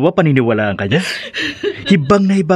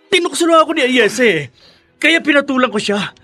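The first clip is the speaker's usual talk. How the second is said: desperate pleading; 10 semitones higher than usual. Acted. Cornered man pleads with his wife.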